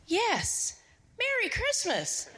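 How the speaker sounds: very high, stretched-out greeting; imitated delighted onlooker. Lady in front answering the boy, imitated high call. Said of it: "a lady in the front goes".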